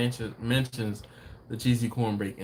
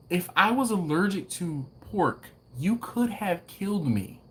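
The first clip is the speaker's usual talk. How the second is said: higher-pitched retold scolding; indignant retelling; 7 semitones higher than usual. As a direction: replay telling off the waitress, pitched up.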